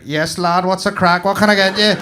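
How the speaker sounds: loud, high, matey greeting; hammed-up local banter; brash local barista. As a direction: call it out like a rowdy counter worker. Acting rough local barista, versus advert.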